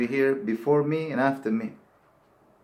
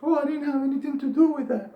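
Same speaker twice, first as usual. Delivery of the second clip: mock innocent denial; 13 semitones higher, faster than usual.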